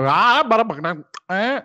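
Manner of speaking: mock foreign gibberish, lightly voiced; mock Hindi gibberish. Mocking the Indian man's speech in fake Hindi. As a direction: jabber fake Hindi syllables. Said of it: "I like your imitation of Hindi".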